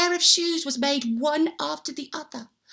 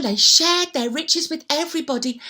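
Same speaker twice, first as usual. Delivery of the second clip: ordinary delivery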